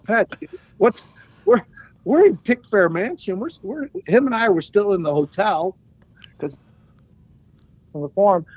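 panicking to your friend at 2 a.m; flustered. Retold or imitated. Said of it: "I go".

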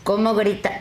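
ordinary talk, nothing marked

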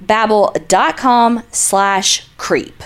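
each word spaced out slowly; repeating the URL slowly for listeners at the back; slow, for the cheap seats. Shown as a demonstration. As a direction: hammer the web address home. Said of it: "One more time for the cheap seats in the back".

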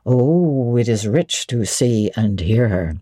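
low and relishing every word; Rochester, delighted. A narrator's reading. Rochester delighting in Jane's spirited reply, low voice. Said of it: "he exclaimed".

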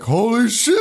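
high-pitched mock shock; unprepared moviegoer. Mimicking man at horror showing, high mock-shocked yelp. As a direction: imitate a genuinely startled horror novice. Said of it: "acts like he was not prepared".

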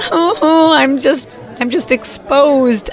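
high, drawn-out mock dismay; mock panic. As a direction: play up feeling helplessly exposed. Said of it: "you're looking around going".

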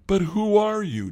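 high, loud challenge; demanding. A narrator's reading. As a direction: demand an answer, voice leaping up. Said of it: "Joshua demanded".

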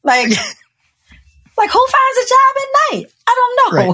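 high, incredulous squawk of disbelief; mock outrage, voice shooting way up; exasperated outburst. To her mother about finding a job at night, a high exasperated squawk. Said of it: "irritated and angry with her".

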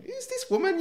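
high, puzzled inner question; baffled thought. Retold or imitated. Thinking to himself, baffled by the probation officer. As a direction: wonder who on earth she is.

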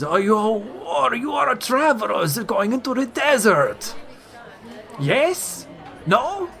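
high, slow, jolly merchant voice; a big, cheerful salesman, pitched high; jovial merchant. Acted.

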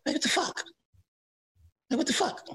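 high incredulous. Retold or imitated. Repeating his own retold disbelief.

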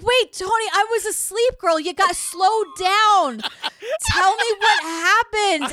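slow, high, hammed-up bewilderment; groggy friend pleading. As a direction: imitate a just-woken friend begging a caller to slow down. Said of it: "And he's like".

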